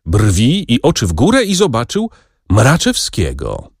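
usual speaking voice

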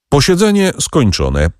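high-pitched formal announcement; announcing. A narrator's reading. Presiding judge closes the court session, announcing. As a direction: announce it to the whole room. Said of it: "oznajmił przewodniczący".

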